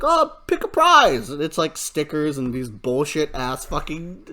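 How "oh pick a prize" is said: dentist voice. Retold or imitated. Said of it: "so they're like".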